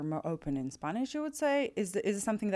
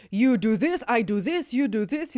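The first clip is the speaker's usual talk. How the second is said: higher, louder mock-serious instructions; 5 semitones higher, 7 dB louder than usual. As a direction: lay down orders in all seriousness. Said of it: "you know, very serious".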